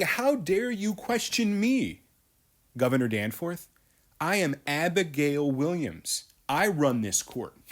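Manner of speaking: high, slow and haughty, a lightly voiced arrogant girl; arrogant Abigail. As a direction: talk down to the governor as if you own the court. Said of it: "She's basically saying".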